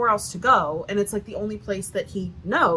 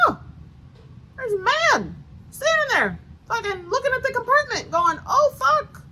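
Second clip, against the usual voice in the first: startled man; 3 dB louder than usual. Retold or imitated. Storyteller mimicking the startled man caught in the compartment, high mock alarm. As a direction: squeak it like a shocked intruder.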